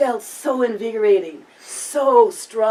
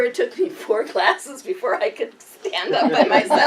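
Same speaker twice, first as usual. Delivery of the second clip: usual speaking voice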